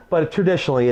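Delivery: ordinary talk, nothing marked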